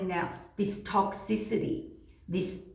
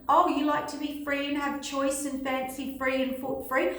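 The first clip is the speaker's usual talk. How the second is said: high, sing-song teasing question; playfully mocking their wishes; mock taunting; 6 semitones higher than usual. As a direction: tease with a raised pitch, rising at the end. Universe taunting freedom lovers.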